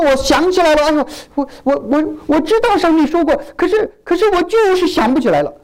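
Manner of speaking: high, fretful voice of someone who can't recall; flustered forgetful believer. Acted.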